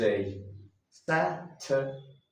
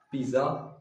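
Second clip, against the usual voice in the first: normal talking voice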